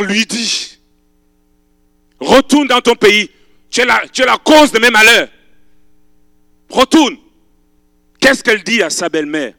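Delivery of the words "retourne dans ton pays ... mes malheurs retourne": raised voice, pushing her away; stern mother-in-law. Acted. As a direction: send her back firmly. Naomi sends Ruth home.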